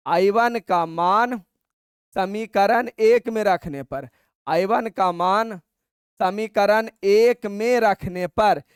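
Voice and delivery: slow, word by word for the class to write; teacher dictating at writing speed; slow dictation. Shown as a demonstration. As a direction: dictate it slowly so students can copy. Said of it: "तो सीधे लिखो".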